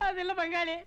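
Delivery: high, weepy pleading; tearful. Acted. Host explaining the feast he arranged, tearful. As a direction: whine through tears. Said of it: "ஏப்பா அழற?".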